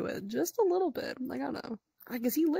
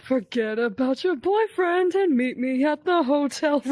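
loud, higher hammed-up demand; mock shouted Freddy; 4 semitones higher, 6 dB louder than usual. Friend hamming up Freddy demanding a hotel meeting. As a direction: belt it like a bossy lover.

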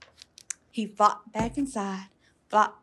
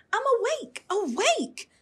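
high, insistent little frog voice; a kid insisting he's wide awake; high, excited childlike frog; eager little frog; 11 semitones higher than usual. A narrator's reading. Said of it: "cried Froggy".

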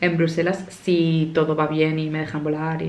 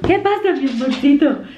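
high, sweet pet-talk voice; 7 semitones higher, 4 dB louder than usual. Real, not acted. Greeting the dog.

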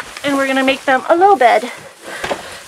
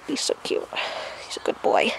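soft unvoiced whisper, much quieter; 12 dB quieter than usual. Real, not acted. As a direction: whisper your praise close to the goat. Whispering over the goat.

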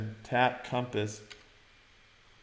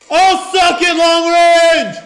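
very loud, high triumphant yell; gloating shout; 17 dB louder, much slower than usual. Real, not acted. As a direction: scream your victory at the enemy. Gloating over long-range kill.

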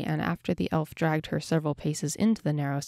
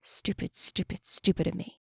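muttered; 5 dB quieter than usual. A narrator's reading. Elf curses himself in his native tongue, muttering. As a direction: mutter it softly to yourself. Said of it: "he muttered in his native tongue".

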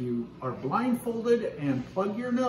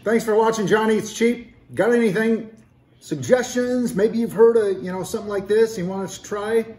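loud, upbeat sign-off; vlog outro; 5 semitones higher, 6 dB louder than usual. Acted. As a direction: pump up the closing pitch.

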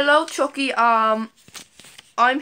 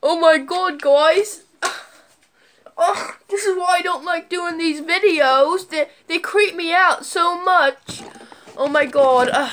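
hammed-up creeped-out whine, raised and drawn out; acting scared; 6 semitones higher, 4 dB louder, slower than usual. Vlogger faking fear at Chucky's call for views, whiny panic. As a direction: whine as if totally freaked out. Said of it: "act like I'm really scared".

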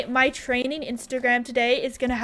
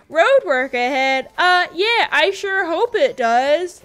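loud, drawn-out American accent; exaggerated slow American Vine voice; 4 semitones higher, 5 dB louder, slower than usual. Shown as a demonstration. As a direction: quote it in a put-on American accent. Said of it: "do an American accent is if I quote a Vine".

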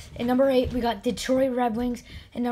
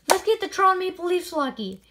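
higher, pleading with the wheel; pitched-up frustrated plea; exasperated pleading; 6 semitones higher than usual. Real, not acted. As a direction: come on, give my team some luck. Pleading with the wheel for his teams.

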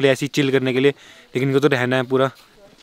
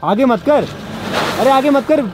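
real urgent call-out, pitched up; urgent warning; 9 semitones higher than usual. Real, not acted. Passenger warning the driver not to go forward, urgent warning.

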